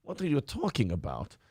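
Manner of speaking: a faint Scottish lilt creeping into a Russian villain; Scottish-tinged Lenin voice. Retold or imitated. Lenin lecturing a shocked activist, sliding into a Scottish accent. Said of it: "Why did I turn Scottish at the end?".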